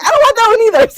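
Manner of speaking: laughing exasperated. Real, not acted.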